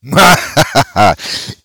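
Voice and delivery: high, rapid staccato laugh; theatrical cackle. Acted. Host answering his own "sound delicious", theatrical cackle. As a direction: laugh it out like a stage villain.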